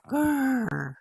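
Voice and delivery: loud mock dog growl; playful growl. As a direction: growl in play at a puppy. Play-growling with the puppy.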